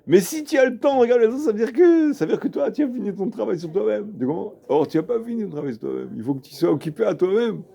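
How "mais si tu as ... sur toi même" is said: high, emphatic reproof; insistent, raised-pitch lecturing; acted rebuke. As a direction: drive the argument home, pitched up. Acted rebuke of the self-satisfied pupil.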